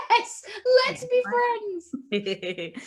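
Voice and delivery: high, gleeful mock squeal; delighted exclamation. Jess answering someone who knows Hull, overjoyed. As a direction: gush as if to an instant best friend. Acting delight at meeting a fan.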